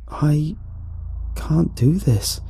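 high, slow, halting words; distressed refusal. A narrator's reading. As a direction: falter in a high, weakened voice. Nauseated narrator refuses to go on.

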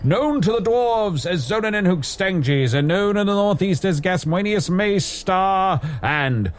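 booming self-important hero reciting his titles; grand heroic proclamation. Acted. Tavern hero to the podcasters, booming grand proclamation. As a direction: declaim every title like a legend.

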